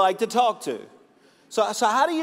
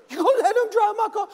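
much higher, louder, faster comic outrage; hammed-up husband whose voice shoots up in disbelief; husband in a comic squeak, much higher and faster; squeaky mock outrage; 4 dB louder, faster than usual. Husband repeats, more outraged.

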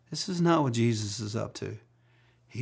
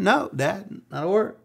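son's answer; 7 semitones higher than usual. Retold or imitated. Son Hunter answering dad.

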